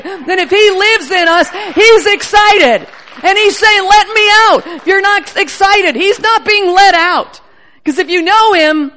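loud, fired-up preaching; excited preaching. Real, not acted. Preacher stirring up the church, loud and excited. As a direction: preach it out at full force. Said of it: "she gets excited".

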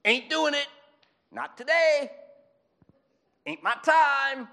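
sassy slang Jesus. Pretend. Jesus refusing Mary at the wedding, cocky slang voice. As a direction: play Jesus as a defiant tough guy brushing off his mother. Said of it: "He didn't talk like that, but it's more fun".

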